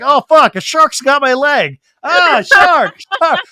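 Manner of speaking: loud, high hammed-up alarm; mock shark-attack yell; mock panic. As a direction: cry out as if attacked, jokingly.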